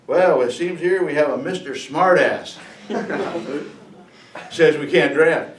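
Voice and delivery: a drill instructor showing up the new recruit; mocking drill instructor. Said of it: "they are not the nicest guys in the world".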